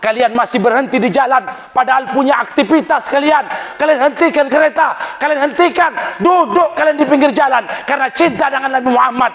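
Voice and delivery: rising, high, swelling preaching; building sermon climax. Acted. Preacher stirring the congregation, building to a high climax. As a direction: lift the crowd toward the takbir.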